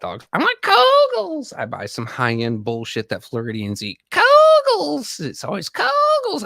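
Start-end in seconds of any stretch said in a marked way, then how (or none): 0.3-1.5 very high, slow whiny wife pleading for Kogels. Retold or imitated. Streamer mocking his wife's Kogels demand in a high whine. 4.1-6.4 very high, slow whiny wife pleading for Kogels. Retold or imitated. Streamer mocking his wife's Kogels demand in a high whine.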